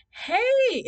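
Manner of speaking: loud, very high squealed greeting; super excited. Retold or imitated. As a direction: greet your crush with hammed-up delight. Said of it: "I'm super excited".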